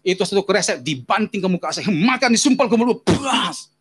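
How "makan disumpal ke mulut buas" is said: higher, forceful burst; explosive retold rage; acted angry outburst. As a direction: act out a furious father attacking. Acts out father's violent outburst.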